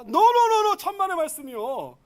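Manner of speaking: high, loud burst of no-no-no, easing off; emphatic denial. Acted. Preacher dismissing a listener's thought, emphatic denial. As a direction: cut the idea off loudly.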